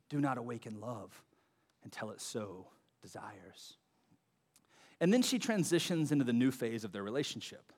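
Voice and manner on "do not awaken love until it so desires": soft, breathy-free whispered warning; whispered caution; hushed plea. Acted. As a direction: drop to a whisper to urge them. Preacher voicing urgent plea.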